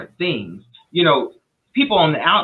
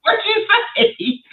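amazed question. Real, not acted. Listener amazed by story.

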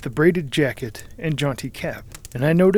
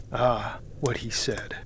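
slow unvoiced whisper of dread; hushed horrified repeat; 3 semitones lower, 7 dB quieter than usual. A narrator's reading. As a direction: let the words sink in horror, barely voiced. Narrator recalls dread.

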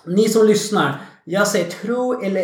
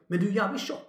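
much softer, laughing blunt joke; dry blunt remark; 10 dB quieter than usual. Retold or imitated. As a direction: drop it softly with a laugh. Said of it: "då säger jag". (lågmält och skrattande rakt på sak)

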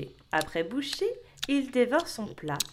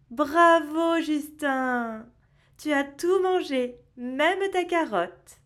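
cheerful praise; 5 semitones higher, 4 dB louder, slower than usual. Acted. Mother praises child.

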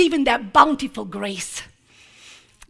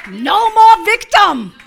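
slow loud proclamation; 5 semitones higher, 6 dB louder, slower than usual. Shown as a demonstration.

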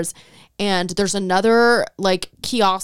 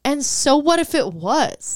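defiant; 7 semitones higher, much slower than usual. Retold or imitated. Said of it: "And I said".

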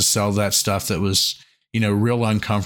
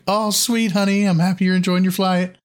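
high, sugary sweet talk; syrupy mock reassurance; 8 semitones higher than usual. Imitating a sweet reply to text.

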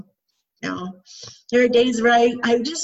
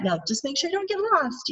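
higher, softer mock warning; teasing; 6 semitones higher, 5 dB quieter than usual. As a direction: gently tease the boys who got lost. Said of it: "we just tease them".

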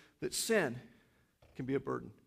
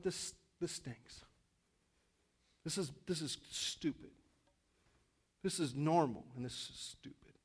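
quiet disgust; 7 dB quieter than usual. Retold or imitated. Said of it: "I was like".

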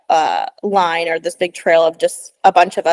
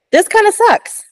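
dog's whiny inner voice; 14 semitones higher, 6 dB louder than usual. Pretend. Dog's feelings voiced by owner.

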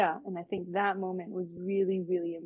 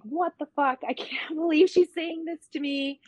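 high, loud, half-laughing outrage; what the hell, pitched up and loud; outraged disbelief; 8 semitones higher, 6 dB louder than usual. Retold or imitated. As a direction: blurt it out in stunned anger, with a laugh breaking in. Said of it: "I'm like".

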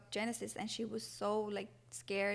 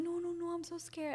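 high, softer mock-frightened refusal; scared sister; 8 semitones higher, 5 dB quieter than usual. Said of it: "and she was so scared".

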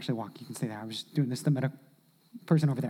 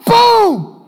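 sudden shouted BOOM, much higher and louder; mock crash; 12 dB louder than usual. Preacher acting out the log hitting a spouse, shouted boom. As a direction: blast the word out like an impact.

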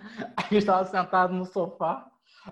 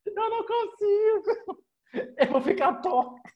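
1 mock crying; 13 semitones higher, slower than usual. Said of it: "Ela chorando".

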